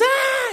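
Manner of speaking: sudden high startled shriek; high scream. Retold or imitated. Little brother scared by his older brother, high shriek. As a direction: let out a startled squeal. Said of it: "Corey screams".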